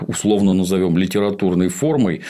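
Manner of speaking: ordinary talk, nothing marked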